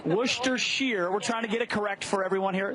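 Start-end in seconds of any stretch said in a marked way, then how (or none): 0.0-1.1 slow, careful Worcestershire said as WUSH-ter-sheer. Shown as a demonstration. Host teaching viewers, slow and careful pronunciation. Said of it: "Show us".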